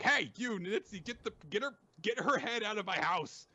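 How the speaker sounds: high-pitched mock scolding; Ren scolding. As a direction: order them out in an indignant character voice. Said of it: "Ren scolds".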